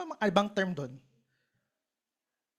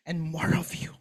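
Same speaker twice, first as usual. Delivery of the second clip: breathed, lower prayer ending; whispered prayer; 5 semitones lower, 3 dB quieter than usual. Acted. Worshipper whispers the end of his prayer to Lord. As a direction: let the prayer fall to a whisper.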